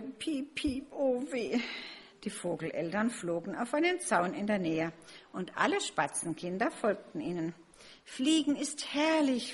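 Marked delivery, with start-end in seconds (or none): 0.0-1.6 small, higher, drawn-out lament; wailing peep. A narrator's reading. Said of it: "Nur Max jammerte". 8.0-9.5 higher, slow, savoured delight; joyful. A narrator's reading. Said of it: "freuten sie sich".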